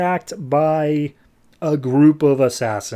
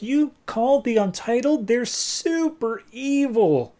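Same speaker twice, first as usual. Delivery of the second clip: chewing out; 10 semitones higher than usual. Retold or imitated. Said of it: "Roy's basically chewing him out".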